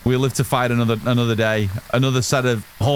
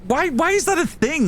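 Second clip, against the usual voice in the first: very high, incredulous retort; exasperated, high-pitched questioning; exasperated protest. Retold or imitated. Acted exasperated retort to the complaint.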